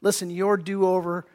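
ordinary delivery

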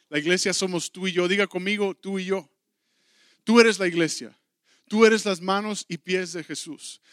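rally the congregation; fervent sermon at full voice; preaching. Acted.

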